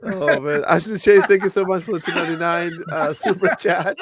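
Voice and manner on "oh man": usual speaking voice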